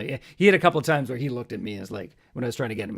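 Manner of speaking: normal talking voice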